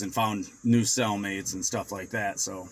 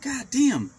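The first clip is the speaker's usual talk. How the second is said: high frustrated god damn; exasperated curse; 10 semitones higher than usual. Retold or imitated. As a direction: spit it out like a sore loser. Retold card game loss.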